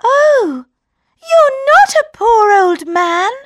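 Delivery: high, loud startled gasp; shocked princess. A narrator's reading. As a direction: princess realising. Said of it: "said the princess".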